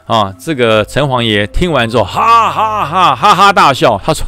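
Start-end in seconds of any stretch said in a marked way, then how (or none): 2.0-4.0 slow, high booming god's laugh; hearty laugh. A narrator's reading. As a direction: let out a big slow laugh. (城隍爷哈哈大笑)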